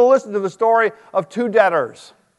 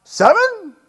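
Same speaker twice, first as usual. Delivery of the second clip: much higher eager offer; proud Peter; 13 semitones higher than usual. Retold or imitated. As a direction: offer it like a prize. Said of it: "So Peter thought".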